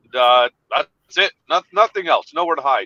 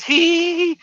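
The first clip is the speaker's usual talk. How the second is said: imitated child giggle; 13 semitones higher than usual. Toddler giggling while hiding.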